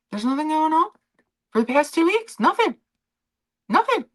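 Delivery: high, mocking voice; mock the royal family, voice up high. Streamer mocking the royals to her viewers, high mock voice.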